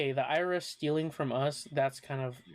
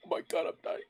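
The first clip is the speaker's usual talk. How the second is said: gasping, high, hammed-up scare; mock fright; 4 dB louder, faster than usual. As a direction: gasp like you've been spooked. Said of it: "can you say "boo" to scare me".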